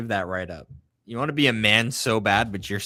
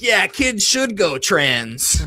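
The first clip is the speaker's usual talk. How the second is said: slow, higher mock-ditzy line; mocking drunk voice; 8 semitones higher, 4 dB louder, much slower than usual. Co-host mocking a drunk liberal tweeter, slow and higher.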